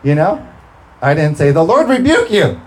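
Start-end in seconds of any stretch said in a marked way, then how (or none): 1.5-2.6 mock rebuke. Mock rebuke of well-wishers.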